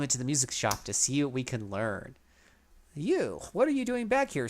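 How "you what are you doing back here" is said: high, startled clerk voice. A narrator's reading. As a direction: jump up in pitch, caught off guard. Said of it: "says the clerk".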